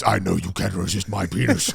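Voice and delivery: a hint of a gloating slug boss; understated sleazy Jabba impression. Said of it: "the Jabba the Hutt goes".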